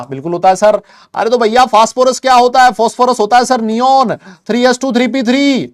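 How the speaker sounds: high, loud declaimed question and answer; loud mock quiz. Teacher playing both sides of a class quiz, loud and high. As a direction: belt out questions and answers like a drill.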